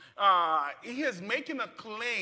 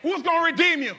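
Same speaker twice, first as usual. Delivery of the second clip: loud, high, building preacher's cry; preaching at full force; 8 semitones higher, 8 dB louder, faster than usual. Acted. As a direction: preach it, lifting the congregation. Call and response with congregation.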